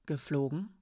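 flat, doubtful echo; flat repeat. Acted. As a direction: repeat it flatly, unconvinced. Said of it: "wiederholte Hiraya flach". (flach wiederholend, ungläubig)

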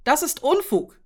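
high, loud protest; calling out. A narrator's reading. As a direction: exclaim it in heated contradiction. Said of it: "rief Nurek".

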